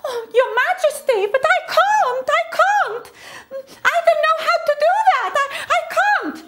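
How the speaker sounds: very high, fast, tearful pleading; high tearful girl's plea; frightened girl. Acted. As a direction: beg the king in a panic. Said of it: "the daughter was like".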